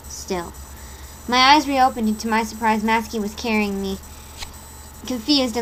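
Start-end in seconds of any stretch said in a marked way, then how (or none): none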